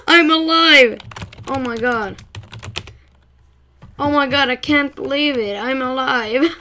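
loud, high, near tears with relief; dawning joy. Real, not acted. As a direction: cry out in disbelieving joy. Survives near death in game.

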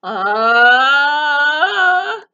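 loud, high rising "aah"; acted falling scream, held long. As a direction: scream as if swept downriver. Friends swept away down the river.

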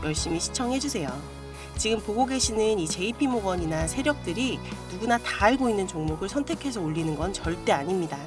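ordinary delivery

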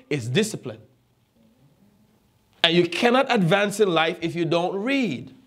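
normal talking voice

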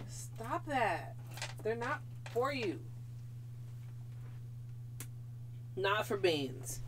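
quiet, gentle telling-off to a cat; hushed firm no to the pet; soft scolding. Real, not acted.

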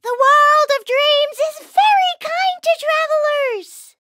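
calling out reassuringly. Acted. Calling down to the falling cookies.

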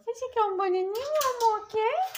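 high, rising cooing offer; baby talk to dog. Acted. Owner offering her dog a banana, high baby talk. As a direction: tempt the puppy with a treat.

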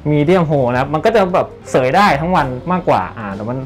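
ordinary delivery

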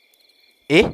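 a quick puzzled yelp; girl puzzled by his question; surprised exclamation. A narrator's reading. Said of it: "นกอุทานออกมา".

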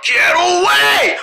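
slow panicked shout. Acted. Being chased.

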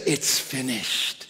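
quiet, solemn last words; hushed dying words. Acted. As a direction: let it fade like a last breath.

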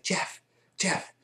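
urgent stage whisper of a name; whisper-call to someone through a door; hushed, high whispered call. Retold or imitated. Friend calling secretly at night door.

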